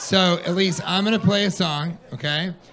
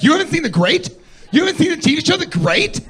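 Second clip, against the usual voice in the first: high, loud disbelief; mock-shocked, voice jumping up high; incredulous; 12 semitones higher, 6 dB louder than usual. Girlfriend baffled he hasn't seen the show, high incredulous.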